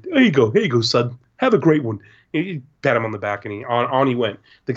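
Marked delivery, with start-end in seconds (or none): 0.0-2.0 lightly voiced kindly send-off; Dan. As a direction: imitate a friendly adult indulging a child. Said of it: "Dan signed it".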